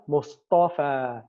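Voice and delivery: drawn-out, very slow "Mustafa"; slow demo word. Shown as a demonstration. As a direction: stretch out "Mustafa" for the class. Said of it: "Mustafa is a word that's mabni".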